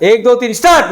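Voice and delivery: rally the room to start; loud count-in. Real, not acted. Trainer cueing the hall, a loud count-in.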